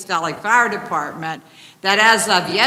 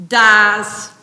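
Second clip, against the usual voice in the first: "dies" hit hard and loud; stressing the exact word; 3 semitones higher, 10 dB louder than usual. Retold or imitated. Resident to county commissioners, hitting "dies" loud and hard. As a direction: land "dies" with a sharp, loud punch. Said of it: "exact word".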